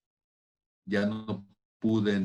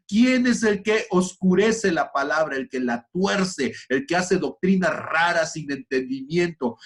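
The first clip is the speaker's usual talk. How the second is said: rising, loud rebuke climbing higher; voicing Job; 7 semitones higher, 5 dB louder than usual. Voicing Job rebuking the proud, loud rising challenge. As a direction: thunder the question like Job. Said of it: "como Job diciendo".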